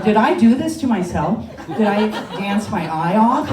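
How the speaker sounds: laughing disbelief. Retold or imitated. Her laughing question to the doctor.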